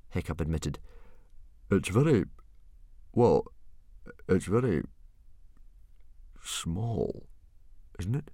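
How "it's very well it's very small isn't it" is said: slow, hesitant. A narrator's reading. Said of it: "said Stoic slowly".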